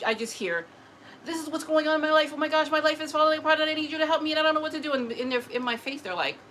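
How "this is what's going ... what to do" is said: fast, high, louder rush of panic; imitating frantic screaming. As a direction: blurt it all out without pausing, imitating desperation. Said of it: "I'm hearing them screaming".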